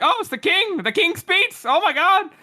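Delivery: high, awed gasp at the king; excited crowd. Retold or imitated. Crowd awed to see the king speak, high excited gasp. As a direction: gush like starstruck townsfolk.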